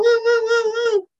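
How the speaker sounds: very high, loud woo-woo; high-pitched siren-like woos; imitated wooing noise. Imitating an odd noise heard.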